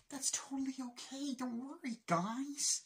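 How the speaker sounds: high, saccharine fake kindness; sugar-coat it sarcastically; mock sweet reassurance. Mock sweet reassurance before insult.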